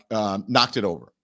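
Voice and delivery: usual speaking voice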